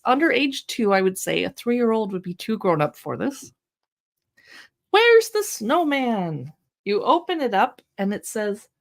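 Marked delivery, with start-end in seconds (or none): 4.9-6.5 put-on slow, higher sing-song question to a baby; enthusiastic read-aloud. Shown as a demonstration. Reading a baby board book aloud, slow enthusiastic sing-song. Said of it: "read it with an enthusiastic tone of voice".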